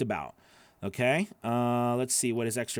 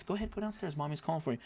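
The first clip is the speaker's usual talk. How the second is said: hushed aside to daughter; 2 semitones higher, 10 dB quieter, much faster than usual. Real, not acted. Parent hushing daughter off-mic, softly.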